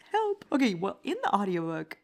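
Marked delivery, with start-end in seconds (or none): 0.1-0.4 high giggle, then help; laughing. Real, not acted. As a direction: laugh at yourself. Laughing at own mistake.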